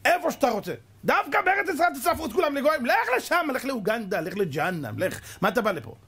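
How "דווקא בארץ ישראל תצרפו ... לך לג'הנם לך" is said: much higher, fast tirade; fiery preaching. Real, not acted. Preacher rails at the secular, high fast tirade. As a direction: thunder at the scoffers.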